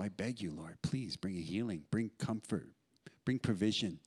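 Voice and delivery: soft, earnest plea to God; softly pleading prayer. Retold or imitated. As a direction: beg gently, keeping it low. Acting out a hospital prayer.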